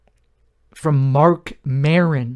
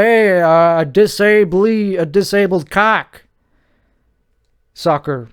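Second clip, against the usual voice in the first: higher, louder cheesy joke-teller setup; hacky joke voice; 4 semitones higher, 4 dB louder than usual. Retold or imitated. Mocking comedians who only tell cheap jokes, in a louder, higher hack voice. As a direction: ham it up like a hack comic. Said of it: "I don't just do jokes like".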